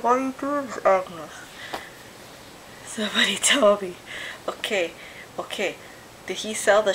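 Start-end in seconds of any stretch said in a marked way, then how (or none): 0.0-1.3 pleading quote. Retold or imitated. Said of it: "All I fucking feel is".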